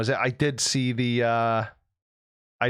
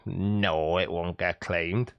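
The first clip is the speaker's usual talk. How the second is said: deep mock answer; 8 semitones lower, much slower than usual. Answers own question in voice.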